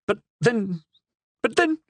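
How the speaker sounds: rising, anguished cry; cried out. A narrator's reading. Pisani cries out to the man in disbelief. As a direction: cry it out in dismay. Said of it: "cried Pisani".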